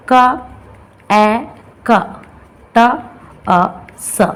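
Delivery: क, ए, क, ट, अ, स each said alone; sounded out. Shown as a demonstration. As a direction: separate each sound from क to स with pauses. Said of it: "known as the two syllable word". (हर ध्वनि अलग-अलग बोलकर)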